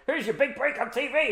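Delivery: high, gleeful villain quip; mock Freddy Krueger. Retelling Freddy's joke, high gleeful villain voice. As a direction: deliver the kill line with relish. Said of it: "was like".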